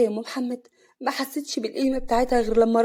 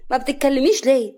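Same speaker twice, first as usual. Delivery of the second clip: higher and louder, wondering concern; puzzled; 5 semitones higher, 7 dB louder than usual. A narrator's reading. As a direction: ask her sister what's wrong. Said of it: "صفيه جت جنبها باستغراب". (باستغراب)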